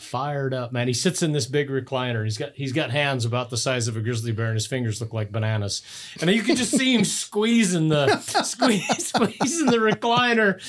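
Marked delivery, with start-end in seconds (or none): 6.2-10.6 laughing. Real, not acted. Laughing while picturing dad.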